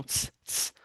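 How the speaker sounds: a very soft voiceless ts ts; tsade sound. Shown as a demonstration. Teacher repeating the final tsade sound, very soft ts. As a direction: hiss out ts twice, barely audible. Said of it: "ele tem o mesmo som".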